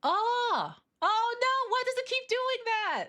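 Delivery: high frustrated cry; startled high wail; dismayed yelp. Real, not acted. Tool glitching.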